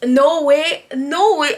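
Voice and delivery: reliving a stunned outburst; excited disbelief. Retold or imitated. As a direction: burst out in disbelief. Reliving shock at seeing idol.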